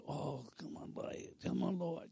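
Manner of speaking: barely audible, hushed coaxing; timid pleading. Retold or imitated. Dieter creeps onto the scale, timidly begging. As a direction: plead under your breath. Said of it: "timidly coming up and getting on it".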